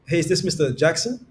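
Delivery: "Hey, is this Mister Jackson?" rising at the end; upward inflection. Shown as a demonstration. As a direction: lift Mister Jackson upward as a curious question. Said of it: "come with an upward inflection".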